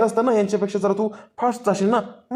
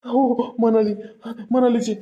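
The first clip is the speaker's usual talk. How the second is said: usual speaking voice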